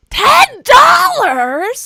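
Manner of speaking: high, drawn-out incredulous shout; shocked outburst. Real, not acted. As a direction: shout it in outraged disbelief. Shocked at the bundle price.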